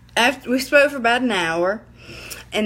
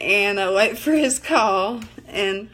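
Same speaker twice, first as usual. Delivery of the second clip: normal talking voice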